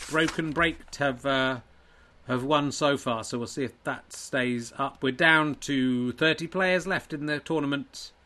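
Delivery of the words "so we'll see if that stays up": ordinary delivery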